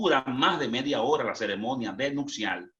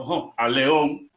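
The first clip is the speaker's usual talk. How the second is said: punchline; 2 semitones higher, 7 dB louder, slower than usual. Retold or imitated. Joking reply to wife.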